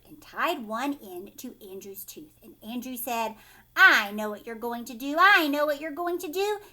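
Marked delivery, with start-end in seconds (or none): 3.7-6.6 put-on loud, insistent childlike outburst; excited guessing. Boy guessing the dentist's plan, excited. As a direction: blurt it out, climbing on the repeat.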